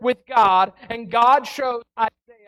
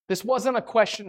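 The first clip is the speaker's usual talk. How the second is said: ordinary delivery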